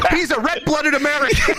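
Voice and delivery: high, laughing impression of a father; hammed-up dad declaration through laughter; imitated dad. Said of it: "And he goes".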